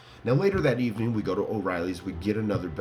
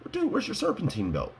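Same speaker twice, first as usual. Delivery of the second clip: high-pitched incredulous question; mock astonishment; 12 semitones higher than usual. To buddy on spotting the missing belt, mock astonishment. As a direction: act out a high, startled double-take.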